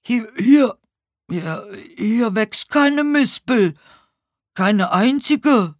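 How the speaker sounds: high, loud, slow and stammering start; bewildered, hard-of-hearing old neighbour; confused old man. A narrator's reading. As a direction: protest loudly in muddled confusion. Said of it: "Verwirrt schaute er sich um".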